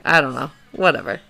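ordinary talk, nothing marked

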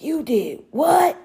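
slowed, drawn-out imitating of a stern father; slow stern dad. Mother imitates the father hearing about the fire, slow and stern. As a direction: stretch it out like a shocked dad. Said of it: "he was like".

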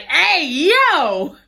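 high, stretched-out startled yell; shocked exclamation. Retold or imitated. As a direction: re-enact being caught totally off guard. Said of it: "I was like".

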